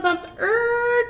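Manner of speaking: ordinary delivery, nothing marked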